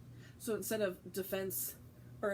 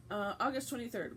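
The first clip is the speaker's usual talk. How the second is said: ordinary delivery, nothing marked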